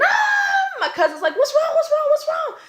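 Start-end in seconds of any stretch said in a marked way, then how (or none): 0.0-0.8 high, loud reenacted scream; piercing horrified cry. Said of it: "I'm screaming". 1.4-2.6 high, loud, frantic repeated questions; urgent high-pitched yelling; panicked questioning. Retold or imitated. Cousin's alarmed questions acted out.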